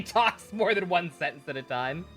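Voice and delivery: ordinary talk, nothing marked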